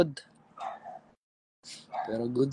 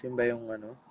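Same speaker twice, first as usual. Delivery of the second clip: ordinary delivery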